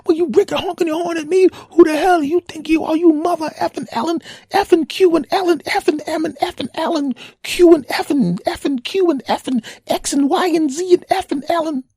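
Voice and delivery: high, loud, squealing mock cursing tirade; cursing rant. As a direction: rattle off censored curses in a shrill fury. Driver keeps cursing.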